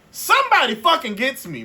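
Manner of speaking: high, very loud outburst; elated shout. Real, not acted. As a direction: cry out at finally being understood. Elated at being understood.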